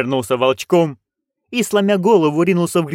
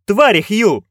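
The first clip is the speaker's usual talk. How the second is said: loud answer; 7 semitones higher, 4 dB louder, slower than usual. A narrator's reading. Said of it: "witches answer loudly".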